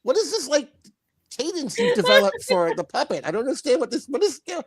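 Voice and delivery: high voice imitating a baffled puppet; a lightly voiced bewildered puppet; mock puzzled Bobby. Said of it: "it's like".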